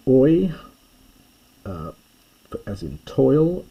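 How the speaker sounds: Oi sung out high and loud like the oi in toil; classical Greek oi. Shown as a demonstration. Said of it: "that's the classical pronunciation".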